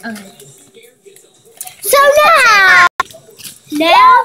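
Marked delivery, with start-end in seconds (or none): 1.8-4.1 loud call. Real, not acted. Announcing next round.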